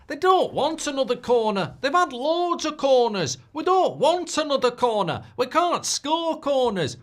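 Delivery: fed up shouting at corners; exasperated rant. Real, not acted.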